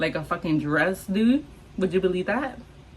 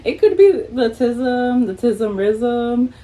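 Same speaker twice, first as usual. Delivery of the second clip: higher, louder and slow, stretching each word; playful drawn-out joke; 4 semitones higher, 5 dB louder, slower than usual. Pretend. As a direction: savor the silly rhyme teasingly.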